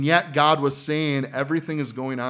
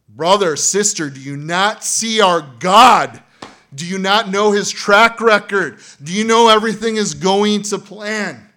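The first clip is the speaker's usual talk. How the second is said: preaching at full force; 8 semitones higher, 14 dB louder than usual. Acted. Pastor rebukes worried congregation.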